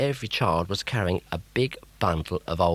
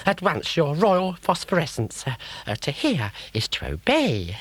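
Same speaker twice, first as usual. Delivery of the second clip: high, dopey crocodile sidekick; simple-minded toady asking his boss; dim crocodile; 10 semitones higher than usual. Acted. Croc grovels.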